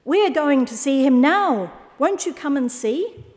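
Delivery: high, eager invitation; excited sheep. A narrator's reading. Sheep inviting owl along, high and excited. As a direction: urge the listener along in a high voice. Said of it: "dozens of excited sheep".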